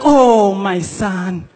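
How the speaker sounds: normal talking voice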